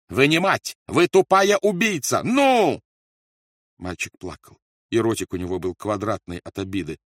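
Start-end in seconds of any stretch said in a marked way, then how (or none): 0.1-2.8 loud, high raging shout; furious yelling. A narrator's reading. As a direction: scream insults at the mother. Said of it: "заорал Рольф". (яростный крик)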